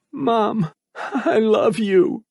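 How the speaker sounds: put-on high, slow boyish whisper of love. A narrator's reading. Boy whispers love to his vanished mother. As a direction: breathe it out, heartbroken.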